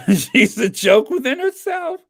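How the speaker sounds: laughing high and loud. Real, not acted. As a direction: speak through a laugh. Laughing at own joke.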